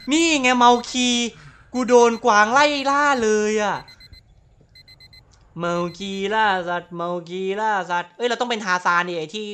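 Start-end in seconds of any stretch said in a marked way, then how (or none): 0.1-3.8 higher and louder, laughing disbelief; excited yell. Real, not acted. Gamer tells viewers he is hunted, excited yell. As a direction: shout it out in delight. Excited reaction to game chase.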